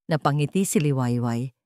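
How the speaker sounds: usual speaking voice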